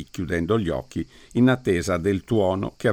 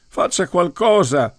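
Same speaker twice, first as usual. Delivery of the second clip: much higher, louder frantic yell; 9 semitones higher, 4 dB louder than usual. A narrator's reading. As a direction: yell for help. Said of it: "urlò Dunn".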